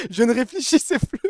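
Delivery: high, loud, talking through laughter; laughing through words. Real, not acted. Streamer admitting to chat he wasn't thinking, laughing. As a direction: confess it while still laughing.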